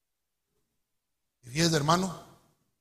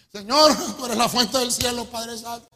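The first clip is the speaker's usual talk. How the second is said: crying out to God; 2 semitones higher, 6 dB louder than usual. Retold or imitated. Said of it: "Ahí sí clamaba yo".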